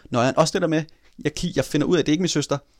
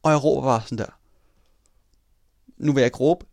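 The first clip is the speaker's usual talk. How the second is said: normal talking voice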